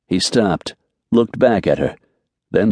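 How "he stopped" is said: ordinary delivery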